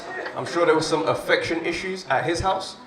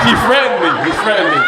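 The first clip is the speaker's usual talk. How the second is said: whiny complaint; 4 semitones higher, 7 dB louder, faster than usual. Retold or imitated. Complaining to teachers.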